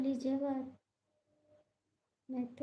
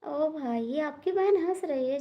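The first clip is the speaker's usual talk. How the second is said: loud call out; 2 semitones higher, 7 dB louder than usual. Real, not acted. Calling out to viewers loudly.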